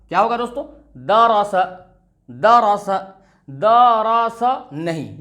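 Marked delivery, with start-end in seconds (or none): 1.0-2.9 दरस with three short, quick syllables; crisp, short दरस; short vowels, read quickly. Shown as a demonstration. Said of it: "जल्दी में पढ़ना है". 3.5-4.4 दरस dragged out as daa-raa-saa; slow, drawn-out दरस; wrongly stretched vowels. Shown as a demonstration. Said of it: "ये गलत हो जाएगा".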